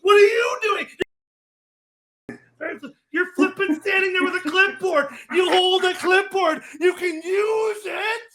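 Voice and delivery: fed-up shout at a coach who blames his team; exasperated shout. Real, not acted. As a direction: yell at the coach, pitch up high. Ranting at the head coach.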